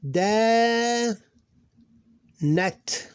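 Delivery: "Da" held long, "Nat" short; extending letter. Shown as a demonstration. Teacher showing a break, long "Da", short "Nat". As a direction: sustain "Da", break, brief "Nat". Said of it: "uh, extending letter".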